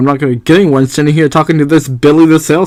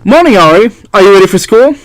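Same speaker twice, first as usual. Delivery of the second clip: brightly voiced dialogue, a touch higher; light character voice; cheery game character; 5 semitones higher, 4 dB louder than usual. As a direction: read the character's line with a little lift.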